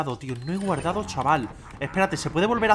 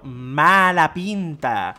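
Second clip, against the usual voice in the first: slow; 3 dB louder, much slower than usual. Real, not acted. Ominous remark.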